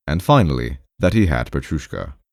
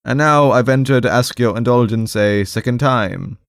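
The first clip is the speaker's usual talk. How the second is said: high-pitched, hesitant petitioner; at a loss for words; 6 semitones higher, 3 dB louder than usual. Acted. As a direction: ask awkwardly in a raised voice. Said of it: "He was obviously at a loss for words".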